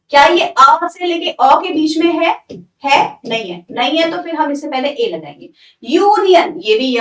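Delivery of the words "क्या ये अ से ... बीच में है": usual speaking voice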